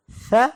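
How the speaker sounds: स said gently with a soft, light hiss. Shown as a demonstration. Teacher showing soft letters: soft स. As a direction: let स out softly, slowly. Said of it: "बड़ी नरमी से अदा होगा".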